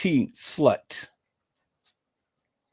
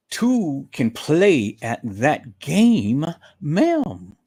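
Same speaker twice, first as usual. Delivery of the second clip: very slow, deliberate taunting; drawn-out taunt; 3 dB louder, much slower than usual. Real, not acted. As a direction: draw out each word as a teasing warning. Drawn-out warning repeated.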